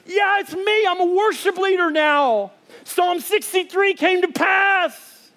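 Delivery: high, excited, slowing at the end; excited shout. Retold or imitated. Josh telling his old friend the prophecy came true, high and excited. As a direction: announce it with joy across the river. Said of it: "He goes".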